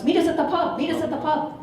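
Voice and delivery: rally the buddies as if ringing round; rallying call. Retold or imitated. Phoning round the club buddies.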